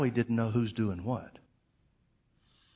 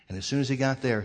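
ordinary delivery

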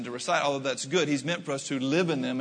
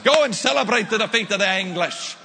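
high, loud, thrilled Scottish cheer; imitating a Scottish homeowner's loud, gleeful send-off; excited Scotsman; 5 semitones higher, 5 dB louder than usual. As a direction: gush like a delighted local urging him to celebrate. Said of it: "he was all excited".

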